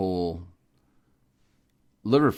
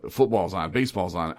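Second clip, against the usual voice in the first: ordinary talk, nothing marked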